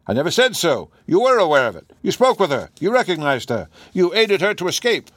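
firm, rising accusation; Holmes accusing. A narrator's reading. Holmes confronting the professor, firm and pressing. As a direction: press each charge harder.

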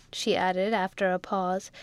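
usual speaking voice

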